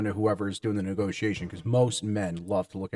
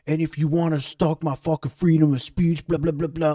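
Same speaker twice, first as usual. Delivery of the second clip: mock rapper; 6 semitones higher than usual. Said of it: "It's like".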